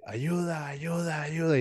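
restrained dream cry for help; mock stifled plea. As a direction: reenact trying and failing to scream. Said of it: "y no podía, y no podía".

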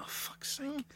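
high, soft mock exasperation; high muted grumble, put on; exasperated co-host imitation. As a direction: imitate his small, fed-up voice. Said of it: "You'd be like".

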